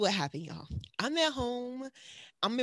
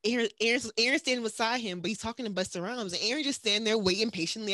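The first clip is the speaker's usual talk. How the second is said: ordinary talk, nothing marked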